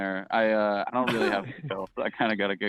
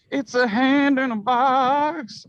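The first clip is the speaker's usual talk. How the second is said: much higher and louder, drawn out slowly; squealed up high; 6 dB louder, much slower than usual. Shown as a demonstration. Reading the plot summary out to the cohost, slow high squeal. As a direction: stretch the line out in a high squeal for the joke.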